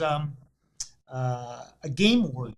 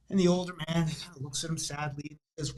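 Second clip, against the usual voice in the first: usual speaking voice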